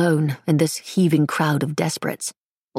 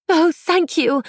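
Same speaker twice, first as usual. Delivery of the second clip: relieved thanks; 13 semitones higher, slower than usual. A narrator's reading. Distressed girl thanks Paddy.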